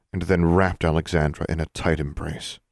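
ordinary delivery, nothing marked

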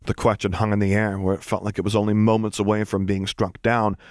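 usual speaking voice

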